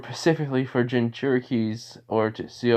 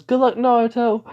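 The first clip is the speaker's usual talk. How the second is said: high, louder good-luck call; cheering him on; 12 semitones higher, 6 dB louder than usual. Acted. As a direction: call out encouragement in a raised pitch. Cheering on a fighter.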